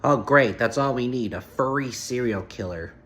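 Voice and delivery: ordinary talk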